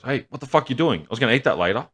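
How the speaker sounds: hammed-up outraged dad; mock angry dad. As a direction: bark it like a furious hillbilly father. Hillbilly dad catching daughter, acted.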